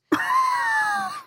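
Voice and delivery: high squealing laugh; helpless sleep-deprived giggle; giddy laughter. Real, not acted. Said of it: "so sleep deprived that all this shit is funny".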